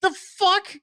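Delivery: very high, loud disbelief; mock incredulous yelp; stunned squawk. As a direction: blurt it in shock. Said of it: "I'm like".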